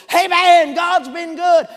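very loud, high interruption; hollered amen. Retold or imitated. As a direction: imitate an uninhibited worshipper hollering over the hymn. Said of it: "He about ruined our doxology".

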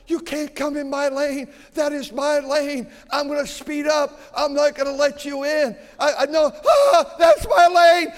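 hammed-up enraged motorist screaming at other cars; ranting road-hog driver. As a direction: yell like a driver who owns the road and won't let anyone merge. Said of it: "It's like".

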